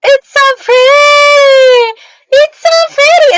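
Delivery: very high, loud gushing squeal; excited squeal. Real, not acted. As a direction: squeal with delight, drawn out. Gushing over newly bought poster.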